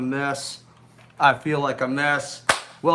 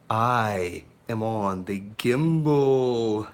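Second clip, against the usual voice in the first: lower, slow, drawn-out unveiling; slow dramatic reveal; 8 semitones lower, 3 dB quieter, much slower than usual. Acted. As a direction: savour the reveal like a big announcement. Showing off his new gimbal.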